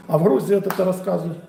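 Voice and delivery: usual speaking voice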